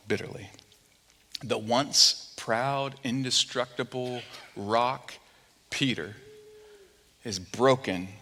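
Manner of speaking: low, soft, hushed storytelling with long pauses; somber preaching. A narrator's reading. Preacher telling how Peter wept and broke, somber. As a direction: let each phrase fall heavily and quietly.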